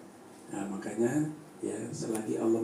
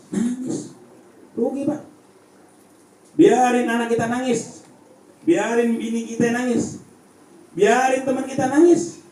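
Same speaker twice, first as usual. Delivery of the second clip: fiery preaching; 12 semitones higher, 8 dB louder than usual. Acted. Preacher to the village congregation, loud and high. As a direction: preach it to the congregation with full force.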